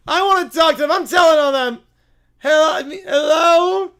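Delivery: put-on high, loud childish outrage; tattling little bear. Bear telling on prank callers, indignant shouting. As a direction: shout like a kid telling on someone.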